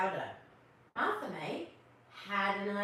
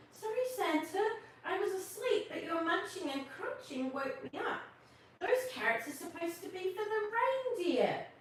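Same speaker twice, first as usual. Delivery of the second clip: put-on much higher little-girl voice; 10 semitones higher than usual. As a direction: speak up high as the small polite girl. Narrator voices Martha May.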